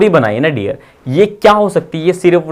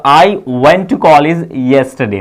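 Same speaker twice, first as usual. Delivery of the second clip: slow model sentence; much slower than usual. Shown as a demonstration. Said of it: "मैं reply बोलूंगा".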